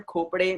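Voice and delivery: खोपड़े with the final "ay" sounded; खोपड़े ending in a clear "day"; correct surname pronunciation. Shown as a demonstration. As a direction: say खोपड़े with its last e voiced. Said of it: "उसको कैसे बोलेंगे आप लोग?".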